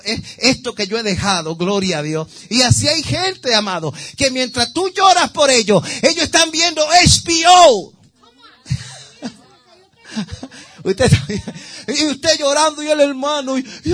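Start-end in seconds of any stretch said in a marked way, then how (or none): 2.5-7.9 higher and louder toward the punchline; rising excitement. Real, not acted. Preacher teasing about people who ignore prayers, excited. 10.2-13.9 talking through laughter; laughing. Real, not acted. Preacher laughing at the careless brother, laughing.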